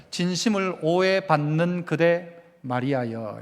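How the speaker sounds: soft and slow, like a line of a poem; slow, soft recitation. Shown as a demonstration. Pastor leading the congregation in a soft, slow recited line. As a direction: lay it down gently, almost tender. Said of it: "한번 따라해 봅시다".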